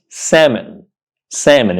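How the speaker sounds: "salmon" as SAM-un, no L; correct pronunciation. Shown as a demonstration. Teacher repeating for learners, "salmon" without the L. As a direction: say "salmon" with a silent L. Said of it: "this word is pronounced".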